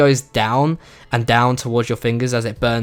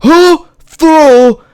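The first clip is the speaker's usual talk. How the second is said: put-on loud, high shriek in a Singapore-English accent; outraged, drawn-out Singapore-English accent shout; 11 semitones higher, 7 dB louder, much slower than usual. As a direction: imitate a furious mum yelling with a Singapore-English accent. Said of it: "in her Singapore slash English accent".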